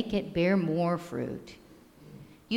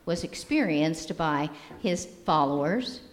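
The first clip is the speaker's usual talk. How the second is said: usual speaking voice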